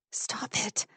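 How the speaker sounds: breathy whispered plea; pleading whisper. A narrator's reading. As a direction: beg her to stop under your breath. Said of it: "she pleads".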